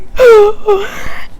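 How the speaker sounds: mock sob gasp. Mock crying about the show.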